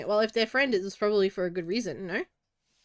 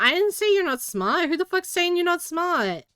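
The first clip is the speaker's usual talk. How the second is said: higher, louder mock outrage; mock indignant; 8 semitones higher, 4 dB louder than usual. Real, not acted. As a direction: play offended at chat. Rebutting chat's accusation indignantly.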